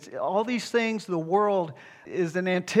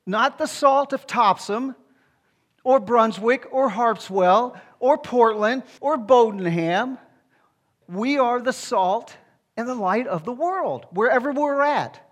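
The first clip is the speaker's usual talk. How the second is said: raised, loud listing of Topsham and Brunswick; fired-up pulpit delivery; preaching at full force; 4 semitones higher, 4 dB louder than usual. Real, not acted. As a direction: press each town name home. Preacher building, listing local towns.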